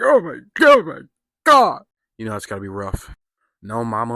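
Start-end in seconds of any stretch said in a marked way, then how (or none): 0.0-1.8 much higher, louder gasp of shock; blurting out horror at the shooting; shocked outburst. Retold or imitated. Reacting to a character shot in the face, shocked shout. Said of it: "And I'm like".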